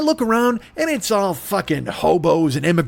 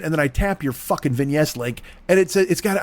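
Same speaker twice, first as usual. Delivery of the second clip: usual speaking voice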